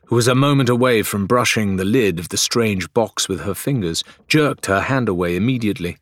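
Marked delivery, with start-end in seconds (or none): none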